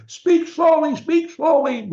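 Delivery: high, loud mock badgering; nagging order. Coach mimics what parents shouldn't say to a child, high loud nagging. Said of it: "we don't walk around and say".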